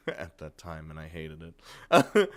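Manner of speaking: ordinary delivery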